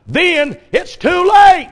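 loud, slow, high shout, punching late; emphatic shout. Real, not acted. Preacher warning parents to start early, slow shout. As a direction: thunder the warning out, slowly.

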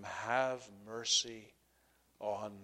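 slow, hushed plea for mercy; soft, weighty, spaced-out pleading; quiet plea. Acted. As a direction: draw it out softly, falling low. Reads out the blind man's plea.

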